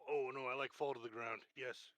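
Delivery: normal talking voice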